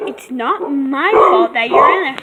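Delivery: loud, talking over the noise. Real, not acted. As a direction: argue back over barking. Arguing with barking dog.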